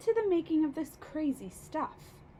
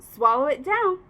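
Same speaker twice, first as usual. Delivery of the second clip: louder urging command; 8 dB louder than usual. A narrator's reading. Boy urges grandma to swallow, loud and firm. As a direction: push her to swallow it, loudly. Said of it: "George cried out".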